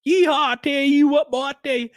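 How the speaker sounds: high, whooping hillbilly parody. Retold or imitated. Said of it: "people with fake redneck accents".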